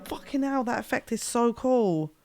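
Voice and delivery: breathless thrill at a new sound; gasping, buzzing with excitement; adrenaline rush. Real, not acted. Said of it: "That's so exciting".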